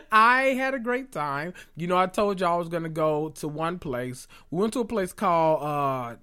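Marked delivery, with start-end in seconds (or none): 0.1-1.5 high, slow, delighted voice; gleeful gushing. Real, not acted. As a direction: savour it in a high happy voice. Gushes after laughing.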